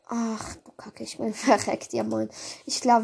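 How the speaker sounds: ordinary talk, nothing marked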